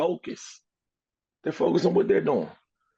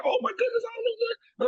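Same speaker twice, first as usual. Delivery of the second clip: high squeaky flustered bird voice; a tiny bird fretting in a squeak; squeaky little bird. Acted. Little bird covered in dung, fretting in a squeaky high voice.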